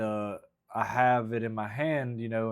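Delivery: ordinary talk, nothing marked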